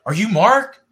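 high, loud, rising retold question; excited question. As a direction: ask like an excited fan, pitched right up. Retold eager question to a celebrity.

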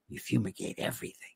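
a hushed, breathy aside; whispered aside. Real, not acted. Comic whispering the punchline to the audience.

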